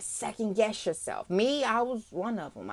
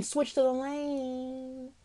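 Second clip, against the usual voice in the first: very slow, softer echo; drawn-out warning; 3 semitones higher, 6 dB quieter, much slower than usual. Acted. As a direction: stretch the warning out slowly. Repeats inner warning, drawn out.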